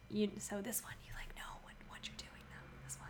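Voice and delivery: unvoiced whisper aside. Real, not acted. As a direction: whisper it off mic. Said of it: "you whispered at me across the microphone".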